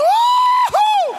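very high, loud cheering whoop; victory whoop. Retold or imitated. Pastor mimics a father whooping after a goal against his son. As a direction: whoop like a grown man scoring on his kid. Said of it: "e grita".

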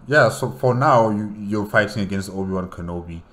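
ordinary delivery, nothing marked

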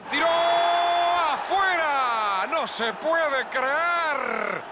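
slow, drawn-out astonished call; stretched-out disbelief. Real, not acted. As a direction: hold each word in disbelief. Penalty shot goes wide.

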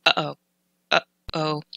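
"uh-oh" with a clear catch between syllables; "uh-oh" split by a glottal stop; glottal stop demo. Shown as a demonstration. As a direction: break "uh-oh" at the throat. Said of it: "illustrate what the glottal stop feels like".

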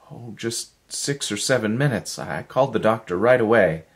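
tumbling out worried explanations to Miller; lightly voiced anxious woman. A narrator's reading. Helen's voice held in story.

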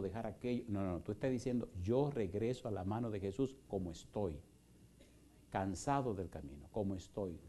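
soft, low, intimate preaching; tender altar-call appeal, hushed and earnest. Acted. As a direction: draw them in gently to come forward.

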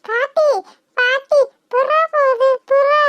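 put-on a child pointing at a pigeon; small child. Little child calling grandma. (சிறுமியின் உற்சாகக் குரல்)

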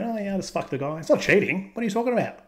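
high breezy voice of a woman shrugging it off; mocking cheating woman. Mock wife coming home to her partner, high and dismissive. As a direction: toss it off like it's nothing. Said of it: "these women coming home and saying".

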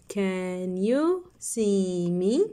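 slow, drawn-out question; slow reading. Shown as a demonstration. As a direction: stretch every word out. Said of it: "Let's read the sentences".

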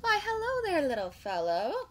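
high, loud greeting easing down; sing-song storybook hello; cheerful calling out. A narrator's reading. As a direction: hail a tiny newcomer cheerfully. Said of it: "Gilbert called out cheerfully".

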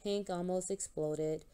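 usual speaking voice

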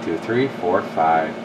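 usual speaking voice

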